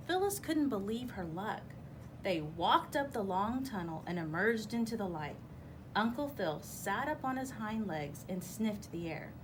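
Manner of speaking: ordinary delivery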